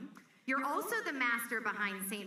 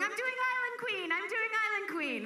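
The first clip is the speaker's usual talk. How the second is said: much higher, excited insistence; imitating an eager friend begging, much higher; eager repeated plea; 10 semitones higher than usual. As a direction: chase someone down with it, pitch way up. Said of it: "chased me down the street a few times going".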